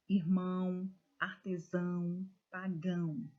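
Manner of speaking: final syllable punched in "Irmão", "artesão", "pagão"; stress on last syllable. Shown as a demonstration. Teacher reading oxytone examples to students, last syllable stressed. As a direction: lean into the closing MÃO, SÃO, GÃO of each word. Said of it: "a última sílaba foi dita com mais intensidade".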